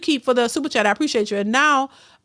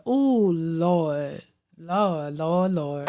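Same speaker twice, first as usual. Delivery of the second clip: disgusted groan; 7 semitones lower, 4 dB quieter, much slower than usual. Real, not acted. Disgusted by described smell.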